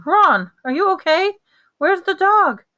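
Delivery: high, loud, urgent questions; alarmed. A narrator's reading. Friends dashing across the room to injured Ron, alarmed.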